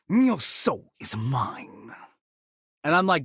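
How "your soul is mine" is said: slow, menacing villain line; Shang Tsung voice. Acted. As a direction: draw it out like a sorcerer. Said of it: "my favorite ... Shang Tsung line".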